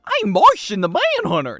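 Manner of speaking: loud, high cartoon voice; cartoon character impression. Streamer testing which cartoon character the voice is, cartoonish. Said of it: "Sounds like a character from Looney Tunes".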